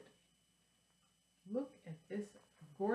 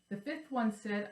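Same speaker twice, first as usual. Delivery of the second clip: lively sing-song read-aloud; reading a children's rhyme; 3 semitones higher, 4 dB louder than usual. Acted. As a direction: read it like to little kids. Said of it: "I'm gonna read this".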